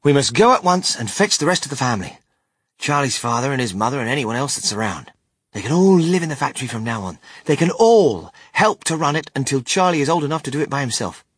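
loud, fast, bustling orders; hurried, take-charge announcement; urgent rush. A narrator's reading. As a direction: rattle off the plan at speed and volume. Wonka hurriedly giving orders.

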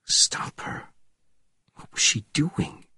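whispered inner thought; urgent whisper. A narrator's reading. Man in pursuit thinking of the nurse, whispered. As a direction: breathe it out in a whisper.

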